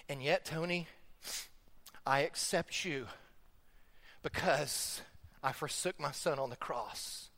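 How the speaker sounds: God speaking tenderly to the pastor; quiet voice of God. Retold or imitated. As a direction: speak low and gentle, as God to the heart. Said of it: "God spoke to my heart".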